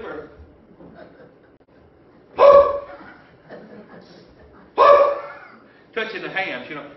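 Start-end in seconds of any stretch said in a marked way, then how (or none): 2.4-5.2 high, loud startled woo; nervous whoop. Acted. Preacher acting disciples creeping up to touch Jesus, nervous whoop. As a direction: let out a jittery yelp.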